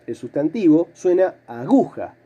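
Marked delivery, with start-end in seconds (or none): 1.5-2.2 "aguja" dicho a-GU-ja, con GU tónica; acento en el medio. Shown as a demonstration. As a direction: marca bien la GU de "aguja". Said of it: "Pero la palabra suena". (aguja, llana, golpe en la segunda sílaba)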